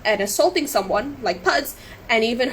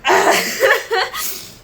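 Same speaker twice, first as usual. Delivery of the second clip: nervous laugh; 11 semitones higher, 6 dB louder than usual. Real, not acted. Laughs off nearly crying.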